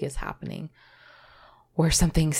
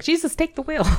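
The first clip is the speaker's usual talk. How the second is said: mock plea; 10 semitones higher, 4 dB louder than usual. Quoting self, laughing.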